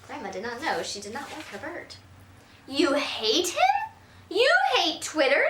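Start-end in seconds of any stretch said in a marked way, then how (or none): 2.7-5.5 high, slow disbelieving question; surprised. Acted. Grandma stunned that her grandchild hates her bird, slow and high. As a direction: stretch it out in shock. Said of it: "Grandma looked surprised".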